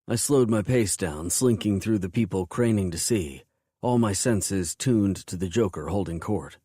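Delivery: usual speaking voice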